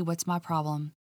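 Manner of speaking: ordinary delivery, nothing marked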